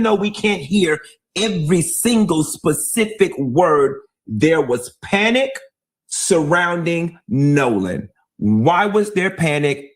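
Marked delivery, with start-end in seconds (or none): none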